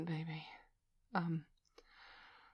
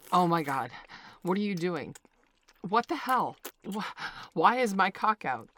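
louder, startled and appalled; shocked outburst; 6 dB louder than usual. Acted. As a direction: blurt it out in sudden shock. Shocked at waking to this.

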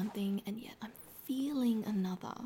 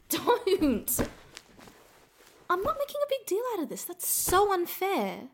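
high, loud, indignant outburst; flustered shout; 10 semitones higher, 8 dB louder than usual. Acted. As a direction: yelp it out, caught off guard.

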